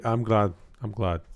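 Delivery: usual speaking voice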